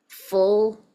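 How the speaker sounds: the word full said loud and stressed, then cut off. Real, not acted. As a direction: blurt full out loudly as a first try. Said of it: "full section or something like that".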